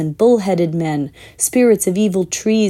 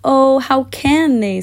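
1 tearful; 6 semitones higher, slower than usual. A narrator's reading. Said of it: "tears streaming down her cheeks".

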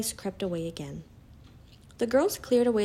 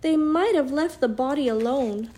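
raised, louder tearful protest; acted sobbing; 5 semitones higher, 4 dB louder than usual. A narrator's reading. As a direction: lament it higher, as if upset to tears. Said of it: "sobbed Lucy".

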